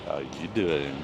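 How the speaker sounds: low smooth chat-up line; deep voice. Acted. Deep-voiced man showing how to chat someone up in a bar, deep voice. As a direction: drop the voice right down. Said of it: "got a deep voice like mine".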